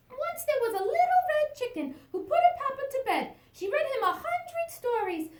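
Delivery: high, eager little chick voice; little chicken voice. Acted. Chick asking her papa for a bedtime story, high and eager. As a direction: squeak it up like a small excited chick.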